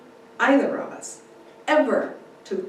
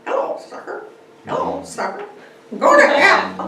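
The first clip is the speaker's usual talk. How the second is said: raspy whispered bird squawk ending in a loud screech; parrot-like mimic bird, croaky then shrill; squawking magpie; 12 semitones higher, 7 dB louder, faster than usual. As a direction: squawk it like a cheeky talking magpie. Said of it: "kept squawking".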